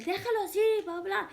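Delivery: high-pitched mock scolding; retold scream. Older lady telling the guys off, retold scream. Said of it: "the lady starts screaming at them".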